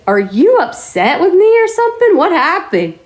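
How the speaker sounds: high-pitched acted-out alarm; mock-upset questioning, pitch jumping up; retold alarmed question. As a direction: ask it in exaggerated, high-pitched concern. Acting out a worried question to friend.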